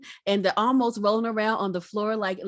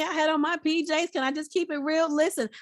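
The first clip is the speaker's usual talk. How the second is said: usual speaking voice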